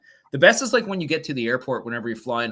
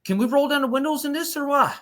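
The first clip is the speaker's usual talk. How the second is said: put-on Newfoundland accent; 13 semitones higher than usual. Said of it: "He's like".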